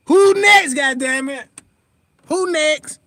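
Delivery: loud, high shout of triumph; real fired-up shout; hyped challenge. Real, not acted. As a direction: call out the next target. Hyped challenge after exposing rivals.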